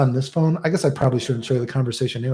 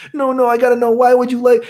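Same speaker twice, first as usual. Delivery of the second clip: high, louder mock-girlish pleading; insistent nervous girl; 11 semitones higher, 4 dB louder than usual. Nervous girl demanding why he likes her, high imitated insistence.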